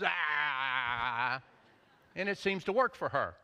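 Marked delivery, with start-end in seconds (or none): 0.0-1.4 a low single bark; one short low woof of satisfaction; content puppy bark. Retold or imitated. Puppy answering the owner's acknowledgement, low short bark. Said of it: "And she goes".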